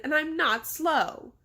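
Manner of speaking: high, slow indignant insistence; shouted. A narrator's reading. Girl called slow in the head, shouting back. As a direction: insist on it, each word drawn out. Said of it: "Sabrina shouted".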